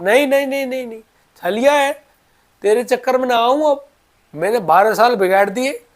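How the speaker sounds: high, loud protest; acted indignant refusal. Baba refuses the trickster Thakur ji, acted indignation.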